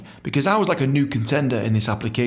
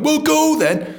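very high loud mock voice; squeaky high brush-off; awkward dismissal; 5 dB louder, slower than usual. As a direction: imitate her awkward shooing. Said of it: "and then went".

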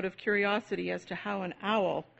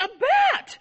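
high, loud sudden shriek of fright; frightened shriek; 8 dB louder than usual. A narrator's reading. Boy startled by a bat, shrieking. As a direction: shriek it out in alarm.

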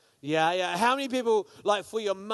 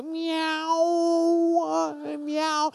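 high, drawn-out cat meow; 10 semitones higher, 4 dB louder, much slower than usual. Shown as a demonstration. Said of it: "All the cat people in the house go".

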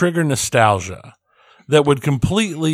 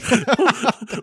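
amused laugh; 10 semitones higher than usual. Real, not acted. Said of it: "I can't even say it with a straight face".

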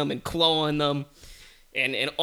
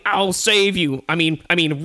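heroic lion; 4 semitones higher, 5 dB louder than usual. Acted. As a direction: bellow it like a lion to the rescue. Lion rushes to save tiger.

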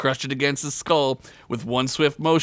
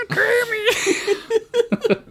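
laughing shout. Real, not acted. Laughing at the Kermit name.

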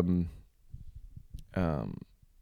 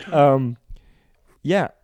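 usual speaking voice

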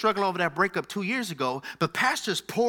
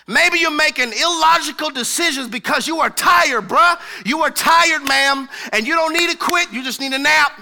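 louder, higher preacher shout; louder, fervent sermon exhortation; full-force preaching; 6 semitones higher, 7 dB louder than usual. Real, not acted. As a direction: call it out to the tired ones.

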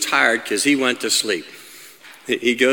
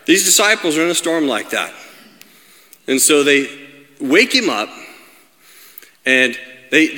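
ordinary delivery

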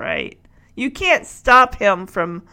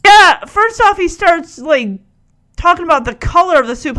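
ordinary talk

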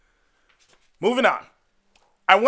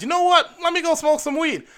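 mock casual self; 11 semitones higher, faster than usual. Hypothetical self voiced.

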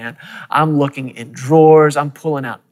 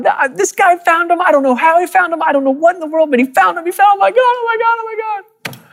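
flipping out; faster than usual. Retold or imitated. Telling the team in the hotel room, flipping out with joy. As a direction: burst out in giddy disbelief, rushing. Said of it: "I'm flipping out".

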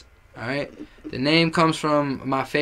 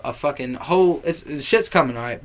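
ordinary talk, nothing marked

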